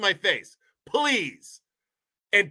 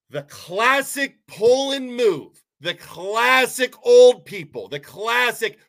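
slow, loud, drawn-out shout; shouting rant; 4 semitones higher, 6 dB louder, slower than usual. Real, not acted. Ranting vlogger shouting at Poland residents, loud and slow. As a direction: belt it out at the town in frustration.

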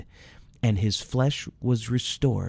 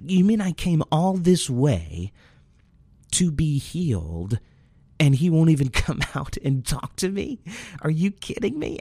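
higher, exasperated disbelief, a mock-indignant Naaman; frustrated indignation; 5 semitones higher than usual. Naaman complaining about Elisha, exasperated and higher. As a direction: retell it like an insulted general. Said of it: "Naaman is getting frustrated".

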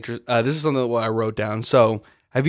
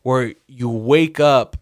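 ordinary talk

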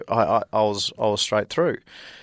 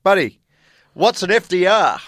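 put-on broad Australian accent; 7 semitones higher, 5 dB louder than usual. Australian student asking the history class, in a broad Australian accent. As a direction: play up the Australian accent for the lecture hall. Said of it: "the accent worked a treat".

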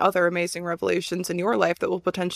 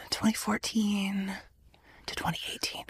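whispering; 10 dB quieter than usual. Retold or imitated. Whispering her own thoughts.

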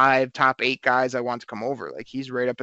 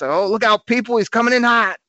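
joking shout; 8 semitones higher, 5 dB louder than usual. Retold or imitated. Joking as the coach drives off.

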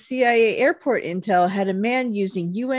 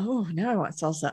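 ordinary delivery

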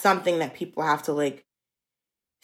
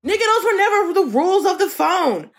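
exasperated laughing rant; 10 semitones higher than usual. Retold or imitated. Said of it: "I'm just like".

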